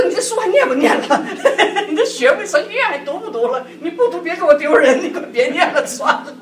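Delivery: half-laughing mock scold of a lazy student; angry scolding. Wife scolding husband who watched TV, laughing mock anger. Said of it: "我就很生气".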